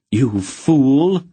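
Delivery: loud, high furious cry; raging. A narrator's reading. Aunt Paul rages at the smith, loud and high. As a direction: rage at him for the danger. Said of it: "Aunt Paul raged".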